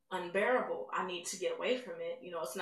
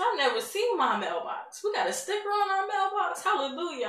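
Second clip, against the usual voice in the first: much higher and louder, overjoyed; ecstatic scream; 11 semitones higher, 8 dB louder than usual. Retold or imitated. Mother seeing her mailbox after blindness, screaming with joy. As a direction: cry out in amazed praise. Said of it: "started screaming again".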